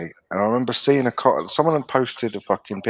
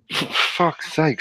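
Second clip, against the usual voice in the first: exasperated sigh; 5 semitones higher, much slower than usual. Retold or imitated. Acting weary reaction to online abuse.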